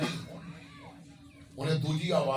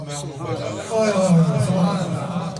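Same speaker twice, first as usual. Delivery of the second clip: divine voice; 2 semitones higher, 10 dB louder, much slower than usual. Retold or imitated. God's voice, drawn out and loud.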